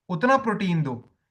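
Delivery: ordinary talk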